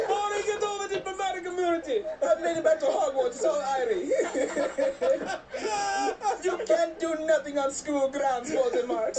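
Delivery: put-on Jamaican accent. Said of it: "At the Jamaican embassy".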